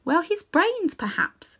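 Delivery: mild whispered elephant; mild reply. Acted. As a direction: answer gently, unbothered. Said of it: "she replied mildly".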